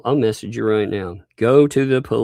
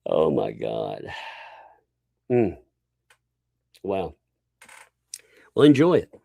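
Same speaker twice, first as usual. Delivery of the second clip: normal talking voice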